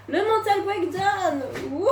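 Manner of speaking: drawn-out high mock drama; milk it like a melodrama; mock dramatic. Ironic dramatic label.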